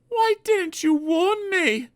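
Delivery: slow, very high reproach; wounded and accusing; hurt engine. A narrator's reading. Peter Sam asking Sir Handel why he gave no warning.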